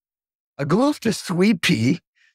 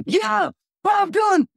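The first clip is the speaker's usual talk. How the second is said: high, slow, shouted announcement; mock excited shout; slower than usual. Producer announcing the [name] record, high shouted mock. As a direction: crow the name like big news. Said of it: "And just".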